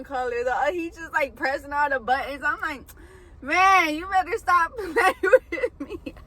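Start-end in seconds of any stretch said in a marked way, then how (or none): 3.4-6.1 higher, louder half-laughing warning; mock threat breaking into a laugh; laughing scold. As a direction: scold him while cracking up. Scared, scolding boyfriend's prank.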